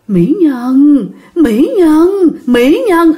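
lightly voiced ghost crooning her name; creepy ghost voice. Ghost clinging to the mother, calling her beauty, lightly eerie. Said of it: "giọng ghê lắm... ồn ồn... giọng đàn ông".

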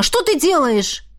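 high-pitched, indignant question; exclaiming. A narrator's reading. Woman protesting as he tramples the jacket, exclaiming. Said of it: "воскликнула она".

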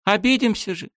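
put-on high, pouty, offended girl's voice; hurt girl. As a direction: sulk like a wounded girl. Miyami's voice in narration.

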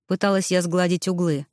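ordinary delivery

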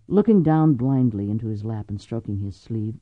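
usual speaking voice

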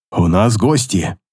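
low, slow, tense orc voice; low tense warning; tense remark. A narrator's reading. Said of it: "Напряжённо заметил он".

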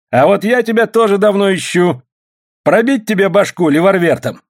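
high-pitched combative threat; threatening. A narrator's reading. Uniformed man threatening Андрюшка, high and forceful.

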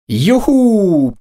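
high, drawn-out victory cry; triumphant whoop. A narrator's reading. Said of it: "победно вскричал Макс". (победный протяжный вопль)

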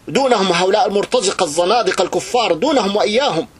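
rally the faithful at full force; fiery preaching. Acted. Preacher hailing news of war on Libya, fiery shout.

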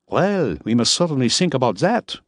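Miller. A narrator's reading. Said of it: "he replied".

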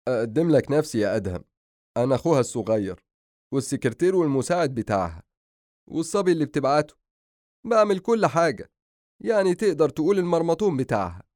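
mounting comic complaint; put-upon younger brother. Acted. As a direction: introduce yourself with mock grievance. Brother introduces himself as her servant.

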